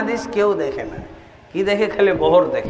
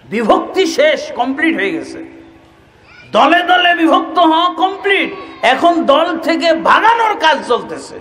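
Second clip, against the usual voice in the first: higher, raised preaching cry; fiery preaching; 8 semitones higher than usual. Acted. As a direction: drive the point home to the crowd. Preacher rising to full force.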